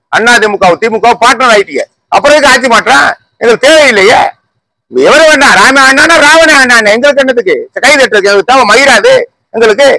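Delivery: high-pitched, heated dismissal; indignant rant. Real, not acted. Heated rant at rival parties. (உயர்ந்த குரலில் ஆவேசமான கோபம்)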